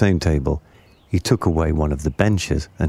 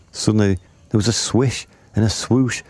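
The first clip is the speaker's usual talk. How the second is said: usual speaking voice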